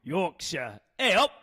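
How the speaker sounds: slow, hammed-up Yorkshire, rising high; Yorkshire accent. Shown as a demonstration. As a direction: announce yourself in put-on Yorkshire. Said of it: "see if you can guess this accent".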